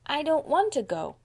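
put-on high, whiny little girl; plaintive child's refusal; protesting. A narrator's reading. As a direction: protest reluctantly. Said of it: "protested Dora".

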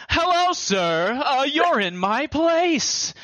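slow, high, stilted wizard impression; badly acted wizard. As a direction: draw every word out like an amateur voice actor. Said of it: "there's this wizard that we ended up killing, and he's like".

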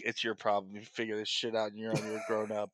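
low, quiet brush-off; dismissive delivery guy. Retold or imitated. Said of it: "this guy's basically like".

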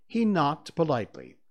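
plain reading voice

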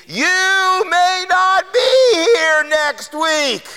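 high, slow, drawn-out yell across a crowded street; retold scream. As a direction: call it out like a street preacher warning him. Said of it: "And he screams at me".

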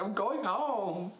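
high, drawn-out mock wail; mock tearful. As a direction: stretch it out like a comic crying announcement. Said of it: "I can't stop crying".

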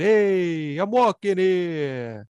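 put-on New York tough guy, Brooklyn accent; slow Brooklyn street accent. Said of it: "We are calling this one Forget About It".

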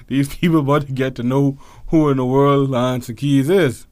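ordinary talk, nothing marked

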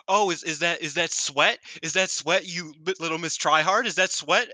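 high, loud sneering mockery; imitating a gloating troll; mocking taunt. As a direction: taunt her like a playground bully. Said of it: "she was just antagonizing her".

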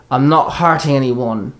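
ordinary delivery, nothing marked

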